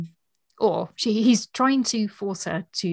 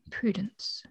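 whispered name; 8 dB quieter than usual. A narrator's reading. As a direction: whisper Prudence softly, with no voice. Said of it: "Sir Anthony repeated".

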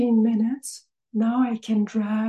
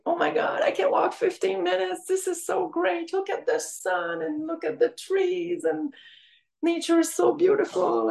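much higher, breathless joy at small things; thrilled wonder; 7 semitones higher than usual. Retold or imitated. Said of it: "So I'm like".

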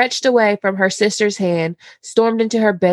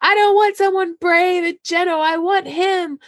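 dreamy Sansa; 11 semitones higher than usual. Retold or imitated. Host as Sansa gushing to her father, high and dreamy. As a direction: gush like a lovestruck girl. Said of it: "And Sansa's like".

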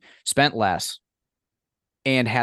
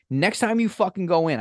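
higher, pushy order; mock bossy demand; 9 semitones higher than usual. Ordering a friend to try the sandwich, in high bossy mock scolding. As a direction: boss him around.

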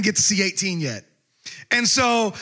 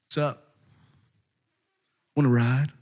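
low, quiet creepy come-on; lowered, softly creepy invitation; creeper; 7 semitones lower, 3 dB quieter than usual. Acted. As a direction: offer a ride like a creep. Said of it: "this is like creeper status".

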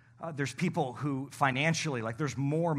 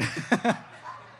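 unvoiced laugh; breathy laugh; 6 semitones higher than usual. Real, not acted. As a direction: laugh at own joke. Laughs at own joke.

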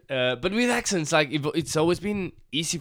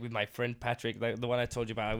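usual speaking voice

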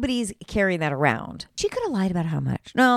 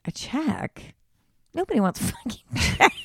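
mock outrage; 5 semitones higher than usual. Real, not acted. Outraged riff at check idea.

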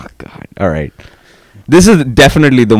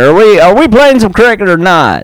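fake Southern accent; 11 semitones higher, 3 dB louder than usual. Teen self mocked, put-on Southern accent at school. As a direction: play a boy faking a down-south accent. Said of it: "just talking real down south".

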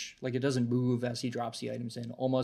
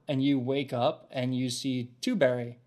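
ordinary talk, nothing marked

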